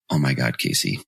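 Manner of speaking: mock-exasperated teacher. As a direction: sigh it out like a fed-up teacher.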